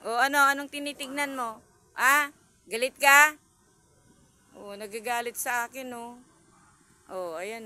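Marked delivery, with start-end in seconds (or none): 0.1-3.3 higher, loud mock confrontation; loud teasing challenge. Real, not acted. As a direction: square up to the cat. Challenging the staring cat.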